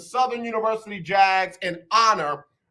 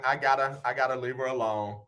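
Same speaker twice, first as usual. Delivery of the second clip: ordinary talk, nothing marked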